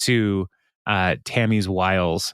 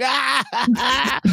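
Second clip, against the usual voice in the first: mock scream. Jam reacting to hair loss.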